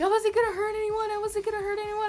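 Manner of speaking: high-pitched panicked self-defence, acted out; freaking out. Freshman explaining herself to the principal, freaking out. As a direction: re-enact frantic pleading in a high voice. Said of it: "freaking out".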